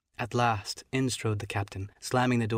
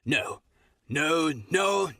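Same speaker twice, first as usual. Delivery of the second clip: crying out; 6 semitones higher, slower than usual. Acted. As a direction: bark a final refusal. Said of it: "he cried once".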